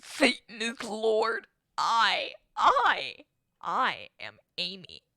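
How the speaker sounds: slow loud possessed voice; demonic voice. Acted. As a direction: growl it out like a demon.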